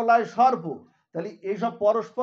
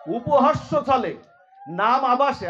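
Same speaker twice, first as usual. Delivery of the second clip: louder, emphatic preaching, laughing in places; preaching at full force; 2 semitones higher, 6 dB louder than usual. Acted. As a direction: drive home the glory of the name. Preaching the glory of the name. (জোরালো প্রবচন)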